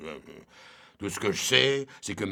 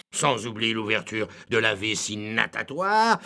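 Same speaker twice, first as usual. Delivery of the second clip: ordinary talk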